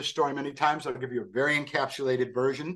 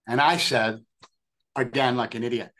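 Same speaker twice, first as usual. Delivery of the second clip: ordinary talk, nothing marked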